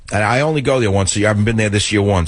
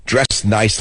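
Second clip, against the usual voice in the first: ordinary talk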